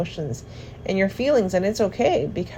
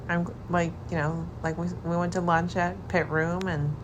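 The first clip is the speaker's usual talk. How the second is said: hushed; 7 dB quieter than usual. Real, not acted. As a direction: talk softly beside a sleeping partner. Partner asleep beside the speaker.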